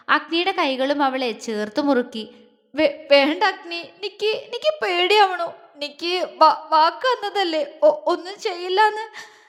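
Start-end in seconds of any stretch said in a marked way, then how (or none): 2.7-9.4 higher, stammering tearful voice; sobbing plea. A narrator's reading. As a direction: beg him through sobs, trembling. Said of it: "തേങ്ങി കരയുന്ന പെണ്ണിന്റെ സ്വരത്തിനോടൊപ്പം". (തേങ്ങിക്കരഞ്ഞുള്ള അപേക്ഷ)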